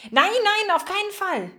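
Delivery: high, urgent protest; alarmed refusal. A narrator's reading. Margarete told to go home, panicked refusal. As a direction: blurt it out in a panic, pitch way up.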